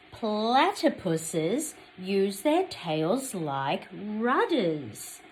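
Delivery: slow, loud, clear picture-book reading; story reading. Acted. Teacher reading a picture book to children, slow and clear. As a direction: read each page slowly to little ones.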